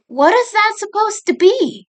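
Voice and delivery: staring at the strange rock, baffled; puzzled sister. A narrator's reading. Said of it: "Monaco asked".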